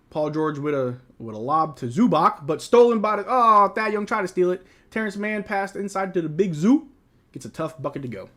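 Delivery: quick, lively game call; broadcast-style play-by-play. Acted. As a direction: call the action as it happens. Calling the game live.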